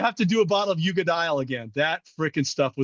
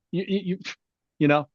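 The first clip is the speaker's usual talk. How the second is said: ordinary talk, nothing marked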